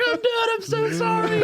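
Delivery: very high, squealed pleading; hammed-up apology from a panicked man; mock surrender. Cellmate surrendering, retold.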